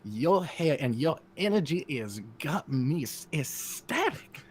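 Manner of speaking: put-on flirt in a Southern belle accent, soaring up at the end. Flattering the fan Verzidax in a Southern belle accent. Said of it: "it has to be Mister Beauregard".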